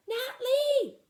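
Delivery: high long ringing call. Acted. Ma calls to her trapped daughter, high ringing call. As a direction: call the name far and loud. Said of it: "Ma called".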